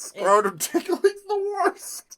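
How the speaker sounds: high, slow, drawn-out squeak; squeaky elf whine. Acted. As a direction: stretch it out in a tiny squeaky voice. Elf complaining, squeaky high drawl.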